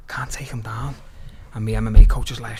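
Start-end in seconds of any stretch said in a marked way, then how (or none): 0.1-1.0 2 hushed whisper to the corner; 3 whisper it like a worried confession. Retold or imitated.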